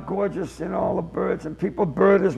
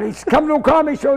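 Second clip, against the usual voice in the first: normal talking voice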